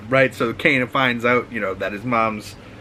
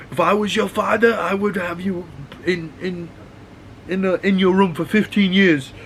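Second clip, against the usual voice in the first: higher put-on voice of a sneering bigot; mock disapproving father; 8 semitones higher than usual. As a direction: lecture her like a condescending stranger.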